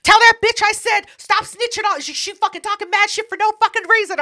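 high, loud angry rant; angry Brenda. Retold or imitated. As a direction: rant about the snitch.

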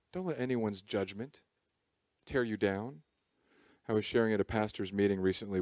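ordinary talk, nothing marked

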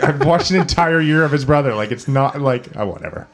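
exasperated, high and loud protest; fed up, ending in a laugh. Retold or imitated. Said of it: "I'm like".

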